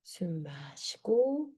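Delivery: soft, slow breathing guide; guided breathing cues. Shown as a demonstration. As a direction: cue each breath gently and slowly. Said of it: "사 초 한번 해볼게요".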